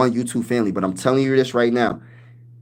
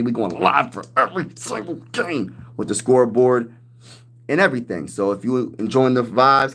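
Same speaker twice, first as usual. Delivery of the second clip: ordinary talk, nothing marked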